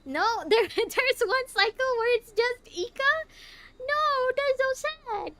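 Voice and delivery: high, loud dismayed whine; distressed wail. Real, not acted. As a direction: wail in comic despair. Dismayed at sad scene.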